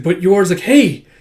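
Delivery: high, loud call. Acted. Someone calling after a girl rushing away, loud call. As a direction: shout after someone to stop.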